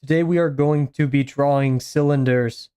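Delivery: stiff, evenly measured robot delivery; robotic AI voice. Shown as a demonstration. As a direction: imitate a text-to-speech presenter. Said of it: "that's too robotic".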